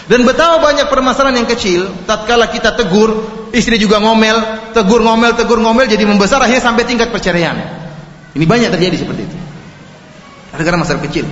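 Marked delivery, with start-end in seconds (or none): none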